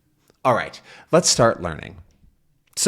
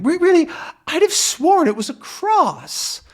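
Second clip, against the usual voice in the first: high-pitched deaf professor, puzzled; old professor voice. Acted. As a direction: put on a doddery old professor.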